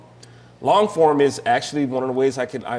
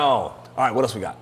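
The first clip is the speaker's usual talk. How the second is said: ordinary talk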